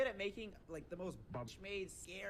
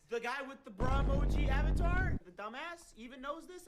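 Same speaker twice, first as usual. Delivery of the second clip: loud rant; 7 semitones higher, 11 dB louder than usual. Real, not acted. Ranting shout.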